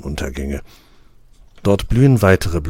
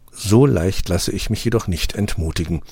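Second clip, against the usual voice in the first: usual speaking voice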